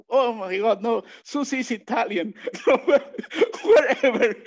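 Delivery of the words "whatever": laughing through the word whatever. Real, not acted. Speaker laughing off his joke about Susie. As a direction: let the laugh carry the brush-off.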